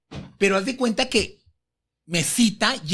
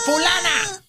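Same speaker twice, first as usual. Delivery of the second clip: high-pitched imitated shout; yelling; 9 semitones higher, much slower than usual. Diva calling for someone by name, yelling. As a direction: call out sharply, mimicking the diva. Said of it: "con esos huevos que gritaba".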